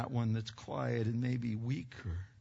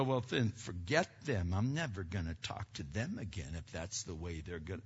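sulky dismissive quote; 9 semitones lower, 6 dB quieter than usual. Retold or imitated. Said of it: "should we go".

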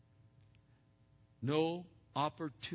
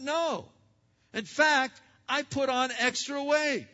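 high, loud back-and-forth between two friends; acted two-voice dialogue; 7 semitones higher, 5 dB louder than usual. Friend and gym-goer trade lines, high and loud. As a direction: play both sides of the chat.

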